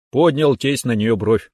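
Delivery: ordinary delivery, nothing marked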